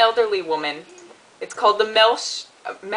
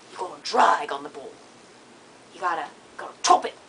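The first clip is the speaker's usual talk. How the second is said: imitated Australian coach, quick at the end; Australian accent; faster than usual. Quoting her Australian hockey coach's funny sayings, Australian accent. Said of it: "field hockey coach once who was Australian".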